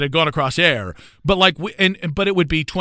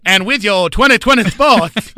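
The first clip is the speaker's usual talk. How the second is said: Dusty Rhodes impression; 5 semitones higher, 6 dB louder than usual. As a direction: ham it up like a wrestling promo. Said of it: "with an impression of Dusty Rhodes".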